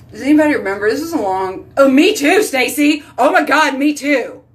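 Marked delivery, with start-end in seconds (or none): 1.8-4.4 higher, louder burst of excitement; gushing, high-pitched enthusiasm; excited agreement. Real, not acted. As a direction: jump in with eager agreement. Delighted agreement with viewer.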